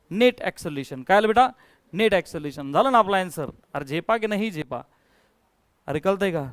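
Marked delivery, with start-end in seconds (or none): none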